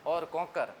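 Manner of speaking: low, soft dialect greeting; gentle low-voiced hello. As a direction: say it softly with the mouth barely open. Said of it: "बहुत धीमे बोल देंगे".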